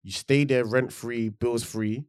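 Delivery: usual speaking voice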